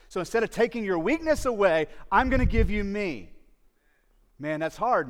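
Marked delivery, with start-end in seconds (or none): 0.1-3.3 loud preached take. Acted. Same words later said quietly.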